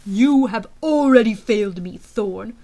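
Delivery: loud rebuke, dropping on the name; powerful angry general. A narrator's reading. General rebuking Thorne for failing, loud and severe. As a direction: pronounce failure with booming weight.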